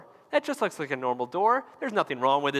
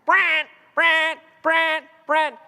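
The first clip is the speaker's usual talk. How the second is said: loud high alarm blare, wailing; imitated alarm; 11 semitones higher, 6 dB louder, much slower than usual. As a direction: mimic a blaring emergency alarm. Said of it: "biggest alarm I've ever heard".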